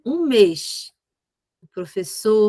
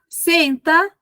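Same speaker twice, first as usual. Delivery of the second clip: put-on a raised, pitched-up "Senta" to get the child's attention; firm command; 7 semitones higher, 4 dB louder than usual. Shown as a demonstration. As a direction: call the child to sit with authority. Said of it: "Então, você fala".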